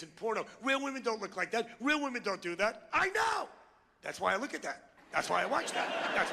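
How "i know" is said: very high-pitched outburst; exasperated shout. Acted. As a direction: shout it back as utterly obvious. Exasperated retort to women's complaint.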